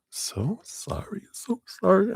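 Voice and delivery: high, drawn-out pretend-weepy apology; mock tearful. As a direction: squeak it out as if about to cry. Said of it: "I'm gonna cry now".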